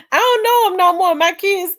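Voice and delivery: high laughing talk. Real, not acted. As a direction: laugh through the words. Laughing at forgotten jokes.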